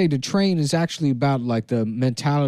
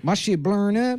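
high-pitched mock Taiwanese accent; put-on Taiwanese accent; 6 semitones higher, 3 dB quieter than usual. Candidate protesting to the moderators, put-on Taiwanese accent. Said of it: "where did you pick this accent up".

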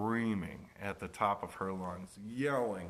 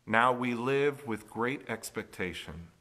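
scripture reading; 4 dB louder than usual. Acted. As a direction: proclaim the verse to the congregation. Reads Scripture aloud.

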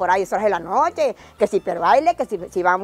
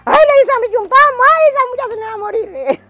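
very high, loud wail of alarm; mother's panicked screaming; 13 semitones higher, 9 dB louder than usual. Retold or imitated. Mother seeing her girl struck dumb, screaming alarm. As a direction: shriek in dramatized maternal panic.

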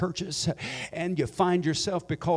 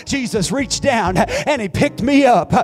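much higher, louder triumphant preaching; joyful Pentecostal climax; climactic preaching; 8 semitones higher, 7 dB louder than usual. Acted. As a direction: shout the testimony to its peak. Climactic testimony.

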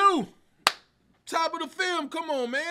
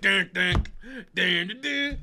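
vocal riff; slower than usual. Retold or imitated. Imitating the guitar riff.

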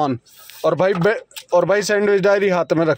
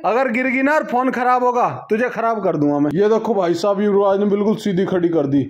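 normal talking voice

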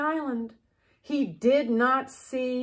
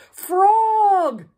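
loud call across the river; shout; 7 semitones higher, 8 dB louder than usual. A narrator's reading. Toad shouting to Frog on the island. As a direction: call out to a friend far away. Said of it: "he shouted".

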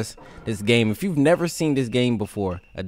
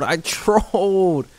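laughing; 5 semitones higher, slower than usual. Real, not acted. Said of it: "I'm trolling".

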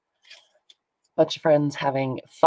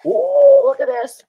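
high, loud delighted squeal; thrilled by a lovely find; excited; 7 dB louder than usual. Real, not acted. Delighted at a found item.

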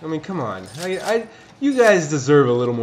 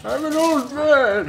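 put-on high, slow creaky old man; 13 semitones higher, 5 dB louder, slower than usual. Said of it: "I think it's perfect".

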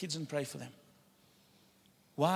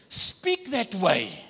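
1 slow emphatic; 4 dB louder, much slower than usual. Real, not acted.

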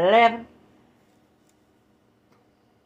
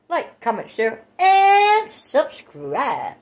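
drawn-out sign-off; 4 semitones higher, much slower than usual. Real, not acted.

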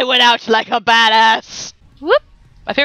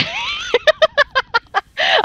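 high-pitched burst of giggles; long high laugh; real laughter. Real, not acted. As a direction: crack up helplessly. Cracks up while hesitating.

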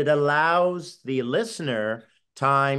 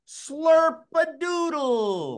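high, slow, sing-song Slurp-a-doodle; playful call; 11 semitones higher than usual. Shown as a demonstration. Host leads co-hosts in a fun chant, playful sing-song. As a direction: call it out for the group to echo. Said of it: "And again".

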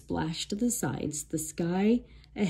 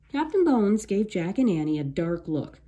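plain reading voice

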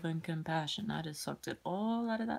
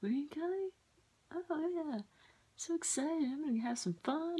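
mock excited friend; 11 semitones higher than usual. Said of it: "it's gonna be like".

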